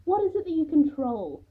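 spat-out, badly faked Russian accent; mock Russian accent. A narrator's reading. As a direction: taunt her in a poor Russian accent. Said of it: "mocking her with a very poor Russian accent".

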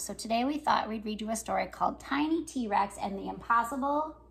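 ordinary delivery

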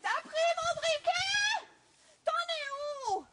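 high, loud, slow witch call from above; shrill-free high calling voice. Acted. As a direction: call down like an impatient old witch. Witch calls down, soldier answers.

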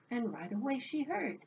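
usual speaking voice

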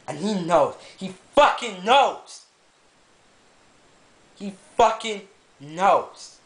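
real angry venting about the broken TV; furious rant. Real, not acted. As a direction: spit out the grievance against his dad. Furious rant about his dad.

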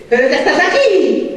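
hammed-up astonished question; mock surprise. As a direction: exclaim it high like an amazed old relative. Grandfather greets her, acted astonishment.

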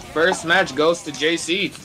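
ordinary talk, nothing marked

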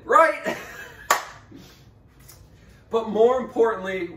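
usual speaking voice

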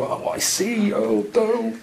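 high hushed realisation; mock dawning realisation. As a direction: act out the penny dropping. Mimicking realisation.

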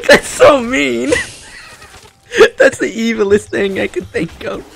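high, loud cry-laughing through the words; laughing hard. Real, not acted. Laughing at her friend's evil mom joke, cry-laughing. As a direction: crack up so hard it squeaks.

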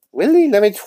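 a high, loud squeak of disbelief; squealed surprise. Acted. Friend reacts to the ambulance vision with a high loud squeak. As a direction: shoot the word up high and loud, amazed.

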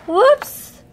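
high surprised whoops; surprised gasp. A narrator's reading. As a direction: gasp as it slips. Hat slips off.

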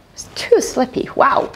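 plain reading voice

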